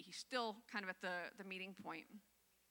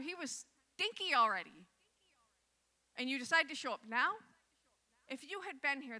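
loud, higher, hurt accusation; accusing sister; 5 semitones higher, 8 dB louder than usual. Retold or imitated. Grieving sisters confront Jesus, loud and accusing. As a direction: throw the blame at Jesus, voice raised. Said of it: "Both of the sisters said to him".

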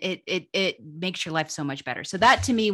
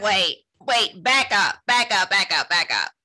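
retold halting, emphatic repeats, a bit louder; insistent, playful stop-right-there; mock urgent interruption; 4 dB louder than usual. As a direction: replay cutting a friend off mid-story. Acting out interrupting upset friend on phone.

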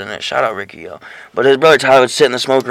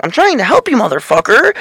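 much higher, shrill-free mock lady voice; Walmart lady; 9 semitones higher than usual. Imitating a preachy lady at Walmart, high mock voice. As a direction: play the ranting Walmart lady. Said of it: "she's like".

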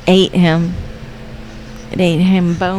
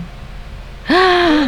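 gasp; 9 semitones higher, 4 dB quieter than usual. Real, not acted. Startled by the creature on screen.

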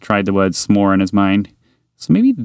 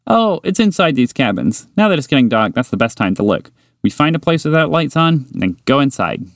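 higher, eager little mouse voice; higher mouse, keen and friendly; 5 semitones higher than usual. Acted. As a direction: tempt a new friend with chocolate. Mouse character explaining.

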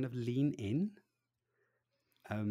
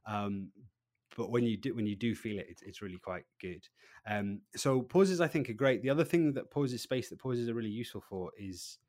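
ordinary talk

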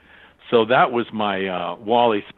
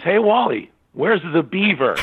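higher-pitched call down the stairs; 6 semitones higher, 3 dB louder than usual. Retold or imitated. Actor calling down to director with a joke, calling out. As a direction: holler the setup line downstairs.

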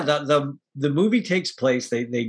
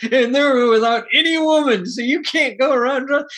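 much higher, louder mock lecture in another voice; hammed-up stern captain; 9 semitones higher, 4 dB louder than usual. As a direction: play the captain scolding her, hammed up. Captain scolds about the crew.

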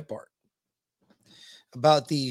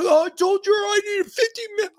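very high, mock-whiny teen excuse; high crying mock whimper of a teenager; whiny son; 5 dB louder than usual. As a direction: whine it like a sleepy kid caught out.

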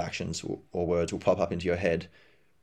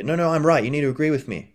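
higher, louder mock stubborn insistence; insisting I'm right; 6 semitones higher, 4 dB louder than usual. Imitating someone forcing others to agree, stubborn insistence. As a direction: push hard, refusing to budge.

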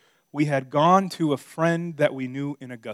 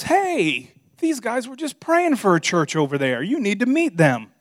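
excited pastor; 7 semitones higher, 6 dB louder than usual. Retold or imitated. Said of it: "And he goes".

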